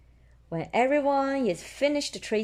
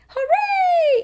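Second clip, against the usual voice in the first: very high, loud cheer; high loud excited cheer; exclaiming hooray; 9 dB louder than usual. Acted. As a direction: cheer with pure delight. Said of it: "exclaims Susie".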